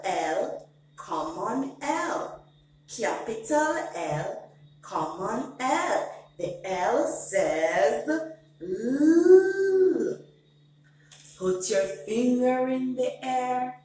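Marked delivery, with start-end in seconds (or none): 6.4-10.2 The L says L with L drawn out into a long luu; slow letter sound. Shown as a demonstration. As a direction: stretch the sound of "L" slowly, as in luu. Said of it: "Every letter makes a sound".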